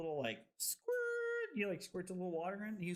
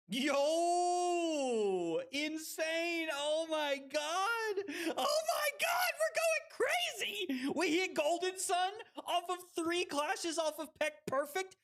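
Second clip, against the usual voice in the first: high, drawn-out hype yelling; thrilled shouting; 9 semitones higher, slower than usual. Real, not acted. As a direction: shout in amazed celebration. Thrilled reaction to lucky game play.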